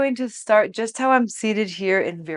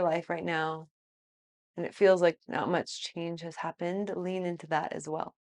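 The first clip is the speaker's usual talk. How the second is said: guided meditation; 6 dB quieter than usual. Real, not acted. Teacher encouraging students to open to new beginnings, soft guided meditation. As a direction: speak softly, leaving space between thoughts. Soft reflection during yoga pose.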